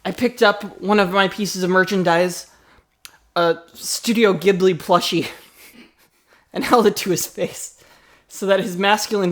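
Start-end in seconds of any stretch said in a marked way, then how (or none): none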